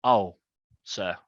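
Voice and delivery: low, soft, deferential barman; hushed, servile reassurance; obliging barman. Acted. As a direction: murmur eager agreement to a customer. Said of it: "the barman's behind there".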